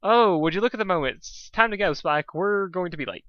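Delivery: fast, high, loud rushed exit; flustered hurry. Acted. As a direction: hurry out in a panic. Hasty escape.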